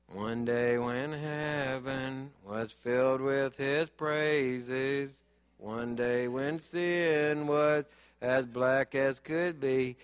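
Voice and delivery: slow, low, lifeless singing-praise voice; half-hearted praise. Retold or imitated. Preacher mocking listless worshippers, slow and half-hearted. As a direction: drag it out with no heart at all. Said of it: "Half-hearted praise is like that to God".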